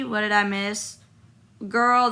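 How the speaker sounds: usual speaking voice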